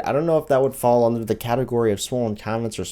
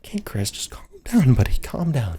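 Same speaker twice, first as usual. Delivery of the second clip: hushed, whispery self-soothing; calming down; 2 semitones higher than usual. Acted. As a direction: talk someone down under the breath. Soothes self after outburst.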